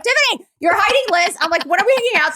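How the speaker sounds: high, loud eager pleading; excited texting. Retold or imitated. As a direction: whine it to a friend playfully.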